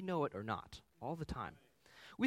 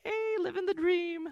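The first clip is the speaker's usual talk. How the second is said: high, slow, hammed-up yay; a father gushing over his new minivan, in hammed-up delight; mock cheer; 6 dB louder than usual. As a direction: cheer it like a kid with a new toy. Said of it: "I'm like".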